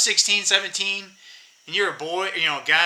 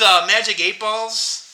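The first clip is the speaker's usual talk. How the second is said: ordinary delivery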